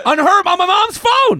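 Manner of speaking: high, loud comic disbelief; exasperated retold outburst; mock outrage. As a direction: exclaim it high and loud, appalled. Retelling outrage at mom's photo.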